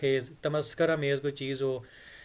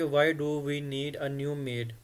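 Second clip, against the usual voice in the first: slow model reading; much slower than usual. Shown as a demonstration. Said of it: "तो फिर भी हम ऐसे ही कहेंगे कि".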